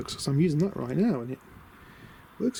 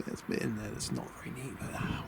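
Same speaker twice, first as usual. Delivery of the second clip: muttered under the breath, much softer; quiet aside; 5 semitones lower, 11 dB quieter than usual. Real, not acted. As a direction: drop the voice to a hushed aside. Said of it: "Be quiet about that".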